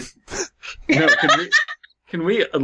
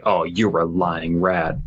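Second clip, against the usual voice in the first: lower, slowed, put-on stilted delivery; mock stiff phrasing; 5 semitones lower, slower than usual. Friend mocking the story's odd phrase, slow stilted put-on voice. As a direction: say it like a clumsy translated line. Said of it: "no one ever says, like".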